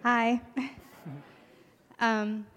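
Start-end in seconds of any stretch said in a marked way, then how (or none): none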